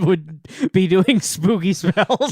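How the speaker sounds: slow, spooky storyteller voice. Pretend. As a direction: drag it out like a ghost story. Mock spooky incantation description.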